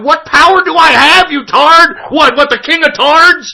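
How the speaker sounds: incredulous yelling; indignant disbelief. Real, not acted. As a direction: throw the questions back angrily. Indignant retort in quarrel.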